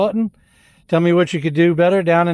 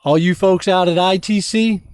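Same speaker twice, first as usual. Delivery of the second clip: ordinary talk, nothing marked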